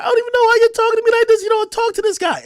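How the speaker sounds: high, rapid mock-whiny protest; imitated upset girlfriend. Girlfriend objecting to how she's talked to, imitated whiny protest. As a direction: rattle it off in a high, mocking voice.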